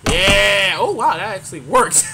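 ordinary talk, nothing marked

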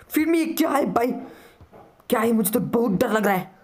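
high, startled, frightened squeal; scared yelp. Real, not acted. Startled by horror game.

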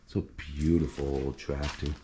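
ordinary talk

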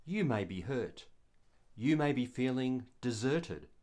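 normal talking voice

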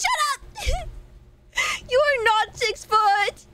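high, laughing shut up; laughing protest. Real, not acted. Streamer laughing off chat's six-foot claim, high squeal.

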